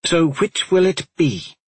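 pointed challenge. A narrator's reading. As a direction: press for an answer, irritated. Continues the snapped challenge.

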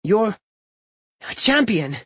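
put-on high, halting boy's wonder; awed admiration. A narrator's reading. Young Tori thanking his brother, full of wonder. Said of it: "Tori finally managed".

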